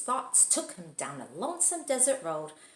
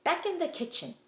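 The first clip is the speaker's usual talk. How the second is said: ordinary delivery, nothing marked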